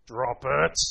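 low, firm dog command; deep command. Shown as a demonstration. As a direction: drop the voice and order the dog. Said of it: "as deep as you can".

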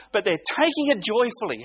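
ordinary delivery

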